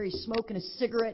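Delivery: normal talking voice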